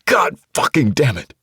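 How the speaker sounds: loud, furious outburst; frustrated curse. Acted. Man cursing as he punches a locker, angry. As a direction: explode in frustration. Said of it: "in frustration".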